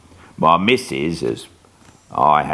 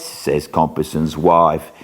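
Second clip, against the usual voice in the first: usual speaking voice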